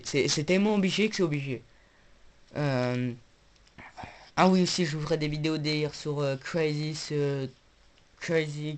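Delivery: ordinary delivery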